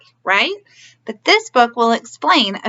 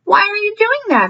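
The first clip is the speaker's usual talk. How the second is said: higher voiced child; curious boy; 9 semitones higher, 4 dB louder than usual. A narrator's reading. Chipito asks Doña Ana in a higher child voice. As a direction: ask it like an eager little boy. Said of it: "he asked".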